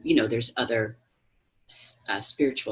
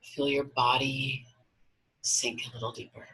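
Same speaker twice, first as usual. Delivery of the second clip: guided meditation. Acted. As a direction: guide them gently into relaxation, unhurried.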